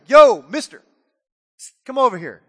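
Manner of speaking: loud, brash parrot hail; parrot calling out. Acted. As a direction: shout for attention, then summon him. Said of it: "And the parrot's like".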